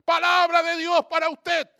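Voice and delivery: imitating her loud, high shouted announcement; anuncio a gritos. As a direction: proclaim it at full volume, held high. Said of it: "Así". (imitación de proclama a gritos)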